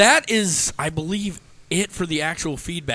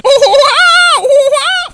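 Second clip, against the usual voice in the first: excited shout; 14 dB louder than usual. Real, not acted.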